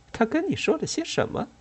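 put-on light teasing girl's voice; playful young woman asking. A narrator's reading. Aisha voiced asking Jack.

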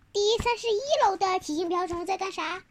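plain reading voice

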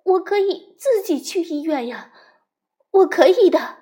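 high, loud anxious insistence; anxious pleading. Acted.

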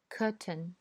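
British curtain, no r sound; curtain with the r dropped, British style; British English. Shown as a demonstration. Teacher reads curtain in a British accent. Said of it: "我先读英音".

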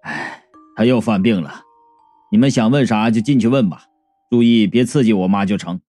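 low, weary son's voice; sigh, then speak low and resigned. A narrator's reading. Son letting visitors in to see his sick mother, resigned. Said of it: "二柱哎了一声".

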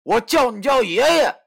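loud, high, furious yell; shouting. Acted. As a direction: bellow the order at him. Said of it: "就大喊道".

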